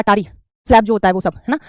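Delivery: ordinary talk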